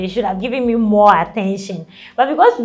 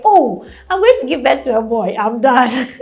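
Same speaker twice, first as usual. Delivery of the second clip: excited; 3 semitones higher, 5 dB louder than usual. Retold or imitated. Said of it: "I was like".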